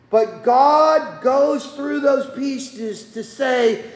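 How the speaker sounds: ordinary delivery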